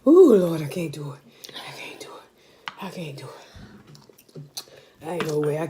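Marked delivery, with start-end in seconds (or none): 0.6-3.6 defeated whisper. Real, not acted. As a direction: whisper it, giving up. Overwhelmed by spicy food.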